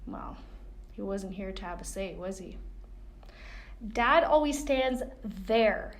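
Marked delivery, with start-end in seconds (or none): 3.9-6.0 slow, loud and pointed; insistent. A narrator's reading. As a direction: drag each word out firmly. Girl insists to mom.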